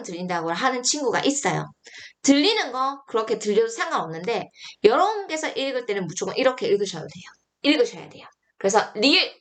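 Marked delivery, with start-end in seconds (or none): none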